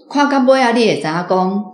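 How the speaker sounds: ordinary talk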